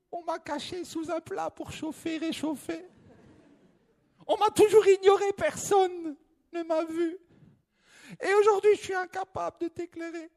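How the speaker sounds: tiny candle voice. Acted. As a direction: sound small and sorry. Candle laments, hiker pleads.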